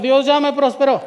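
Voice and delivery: loud call-and-response lead; rallying proclamation. Real, not acted. Preacher leads the congregation to say it with him, loud. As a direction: proclaim it loudly for a crowd to echo.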